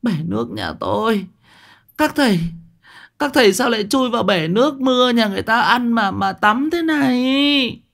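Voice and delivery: high wailing scolding; wailing aunt. Acted. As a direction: shriek at the monks in the well. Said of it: "Bà cô Sim cũng rú lên". (bà cô Sim rú lên trách móc, giọng rất cao)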